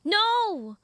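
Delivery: high, loud "no"; forceful shout. Acted. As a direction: shout to stop an attack. Stops redcap stomping the pixie.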